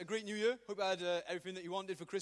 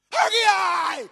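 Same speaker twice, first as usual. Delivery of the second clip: screamed Haggai, much higher and louder; Haggai battle cry; 11 semitones higher, 19 dB louder than usual. Shown as a demonstration. Preacher shows youth how to scare off a bully, screaming. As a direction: scream Haggai in a troublemaker's face. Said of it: "look at them and just go".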